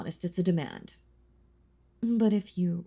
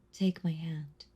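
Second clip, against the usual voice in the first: whisper. Acted. Vampire offering her hand to the hunter, whisper. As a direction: coax them with a whisper.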